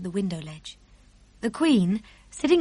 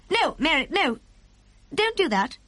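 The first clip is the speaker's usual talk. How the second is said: sharp, higher-pitched queen; sharp command; 7 semitones higher than usual. A narrator's reading. Queen stops maid removing the girl, sharply. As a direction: cut the maid off firmly. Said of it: "The Queen spoke so sharply".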